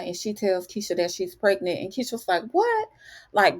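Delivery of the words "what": shocked what. Retold or imitated. Keisha hears Tiff is pregnant.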